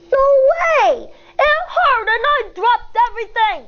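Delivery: put-on a hurt kid snapping back at his teacher. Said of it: "Schubert snaps".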